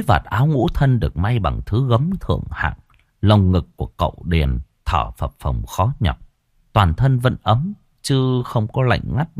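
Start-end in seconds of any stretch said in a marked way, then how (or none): none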